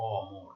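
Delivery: অমর with open "aw" at the start; open vowel. Shown as a demonstration. Teacher giving students an example, অমর with open "AW" first. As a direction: say অমর as "AW-mor", keeping অ open. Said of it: "অ এর উচ্চারণ অ এর মতো".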